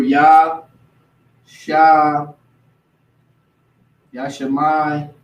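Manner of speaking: Yah, Shah, Yashemai said slowly with pauses between; Hebrew name demo. Shown as a demonstration. Showing friends the Hebrew name, slow syllables. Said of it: "this is how they would say the name in Hebrew".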